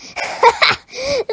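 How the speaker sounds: loud high snorting laugh; scornful snort laugh. Acted. Caretaker laughing at the boy, scornful snort. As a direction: snort with contempt. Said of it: "Mister Grouch snorted".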